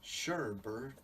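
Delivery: Sherbert drawn out slowly, ending on a rise; savoring the word. Shown as a demonstration. Mulling over the odd name, saying Sherbert slowly. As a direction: roll Sherbert around like a strange word.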